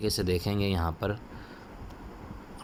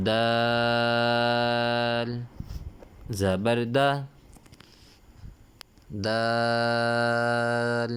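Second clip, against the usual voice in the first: Qaida recitation drill; 4 semitones higher, much slower than usual. Shown as a demonstration. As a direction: stretch every letter name out for the class. Letter drill chant.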